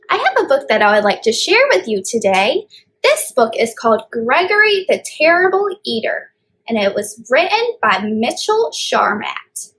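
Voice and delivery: ordinary talk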